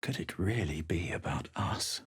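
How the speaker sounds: hushed, breathy whisper to herself; barely voiced, wondering aloud. Whispering her doubt to herself.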